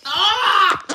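loud yell at sour taste; sour shout. Real, not acted. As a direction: yell out at the sourness. Sour candy in mouth.

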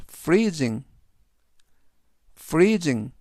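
freezing, said carefully as FREE-zing; teacher modelling freezing; model pronunciation. Shown as a demonstration. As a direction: say freezing clearly for repetition. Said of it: "freezing 이라고 발음하시면 되겠습니다".

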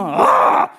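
high, sharp reenacted cry of anguish; retold scream. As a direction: let out a mock scream at God. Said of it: "గట్టి గట్టిగా అరుస్తున్నా". (ఆక్రోశంతో ఎత్తైన అరుపు)

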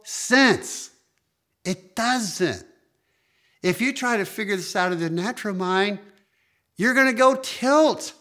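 high, loud, fervent preacher's cry; preaching at full force. Acted. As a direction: drive it home to the congregation. Preacher emphasising supernatural point.

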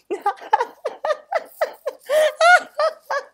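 high, loud laughing fit; hearty group laughter. Acted. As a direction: laugh like the whole class. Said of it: "shook with laughter".

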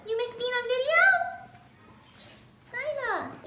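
pinched voice. Real, not acted. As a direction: coo at the cat in a squeezed voice. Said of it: "you change voices when you talk to her".

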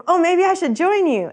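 playing the mentor keen to jump in; eager offer. Retelling the mentor's offer, eager and high. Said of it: "He's like".

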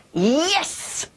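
high joyful yes; a pretend-happy cheer; excited yes. Shown as a demonstration. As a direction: throw it up to the ceiling, delighted. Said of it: "in a really excited way".

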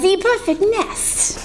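put-on high French-accented duck voice; duck's French cry. French duck admires the nest, high excited cry. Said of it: "she cried".

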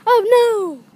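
loud, high cry of dismay; mock alarm. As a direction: yell it in comic panic.

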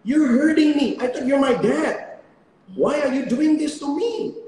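put-on high, loud, pleading little-kid voice; imitated hurt child. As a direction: play the baby begging daddy to stop. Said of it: "waktu itu dia mungkin berpikir". (suara anak kecil yang tinggi dan memelas)

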